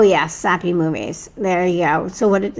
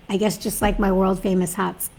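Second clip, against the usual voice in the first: ordinary talk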